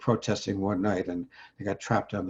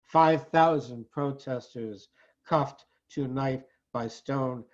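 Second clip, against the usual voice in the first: poem recitation; 7 semitones higher, slower than usual. Acted. Poet telling of five thousand protesters trapped, solemn declamation. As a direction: declaim each phrase with deliberate pauses.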